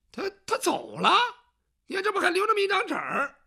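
a high, hurried excuse from a servant; flustered servant. Acted. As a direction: rush it out in a fluster. Servant flustered showing note.

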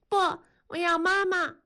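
put-on high, childish protest; small child refusing. A narrator's reading. Toddler refuses father's hug, high childish protest. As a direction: whine it like a toddler.

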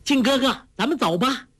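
put-on very high, girlish call to a companion. A narrator's reading. Heroine calls her companion to ride off, high-pitched call. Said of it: "叫道".

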